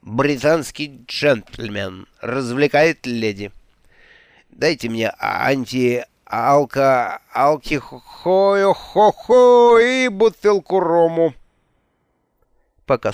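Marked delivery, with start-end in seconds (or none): 0.0-11.3 very slow, slurred stumbling speech; drunk slurring. Acted. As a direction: stumble over the words drunkenly. Drunk Alex stumbles over words.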